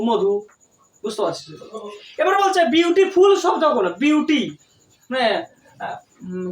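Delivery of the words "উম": ordinary talk, nothing marked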